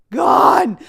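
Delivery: "Gone." shouted high and loud; loud, high "Gone." repeated for emphasis; emphatic gone. Shown as a demonstration. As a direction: belt "Gone." like a punchline.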